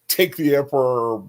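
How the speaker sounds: ordinary delivery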